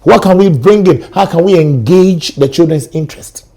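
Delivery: ordinary delivery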